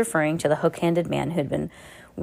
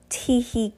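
villain's tee hee; 6 semitones higher than usual. Acted. Villain's tittering laugh.

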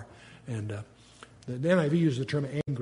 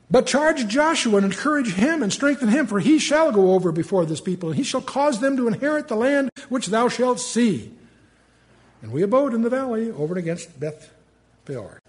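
much higher, louder verse reading; scripture reading; 7 semitones higher, 5 dB louder than usual. Acted. Teacher reads God's command to Moses. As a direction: proclaim the verse grandly.

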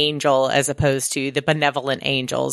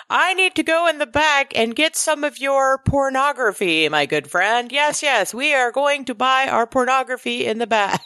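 much higher, overly formal stiff voice; hammed-up stranger trying too hard to sound normal; awkward demon blending in; 9 semitones higher than usual. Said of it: "He's like".

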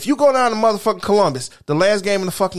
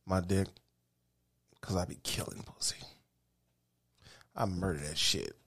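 low boast; 13 semitones lower, 17 dB quieter than usual. Real, not acted.

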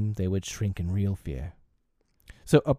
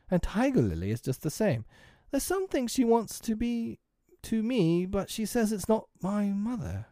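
high-pitched, baffled musing; puzzled; 12 semitones higher than usual. A narrator's reading. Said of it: "frankly puzzled".

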